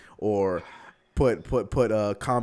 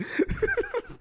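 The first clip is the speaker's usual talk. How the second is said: high wheezing squeal of laughter; a helpless high squeal. Real, not acted. Host squeals with laughter at the Oakley joke.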